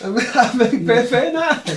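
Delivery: high dopey voice through laughter; hammed-up proud dimwit, giggling; simpleton voice. Player repeats the bed boast, dopey and laughing.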